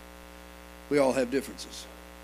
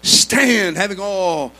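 loud, high, driving sermon with rhythmic uh's; fiery Pentecostal preaching; preaching at full force; 3 semitones higher, 16 dB louder than usual. Acted. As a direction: preach it hard to the congregation.

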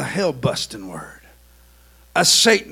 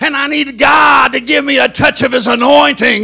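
full-force preaching; 10 semitones higher, 12 dB louder than usual. Acted. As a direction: cry it out to heaven.

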